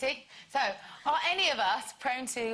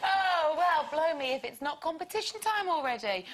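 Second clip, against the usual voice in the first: loud, higher presenter's feigned surprise; hammed-up surprised link into a competition; mock surprise; 7 semitones higher, 6 dB louder than usual. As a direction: announce it as if suddenly surprised.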